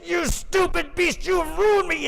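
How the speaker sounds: high, loud furious cry at the ox; furious yell. Acted. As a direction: lash out in rage, pitch way up. Farmer turns on his ox.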